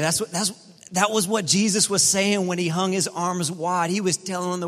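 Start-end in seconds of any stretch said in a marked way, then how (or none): none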